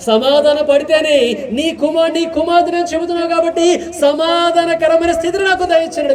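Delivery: high, ringing prayer voice; impassioned pulpit prayer; fervent prayer. Acted. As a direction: cry out to God for the congregation.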